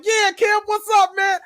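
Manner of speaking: high, loud, over-the-top hello; overexcited shout. Retold or imitated. Starstruck fan butting in to greet Kev, loud and high. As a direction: blurt it out too eager and loud.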